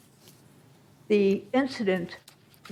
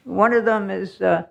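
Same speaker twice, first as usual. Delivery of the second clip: normal talking voice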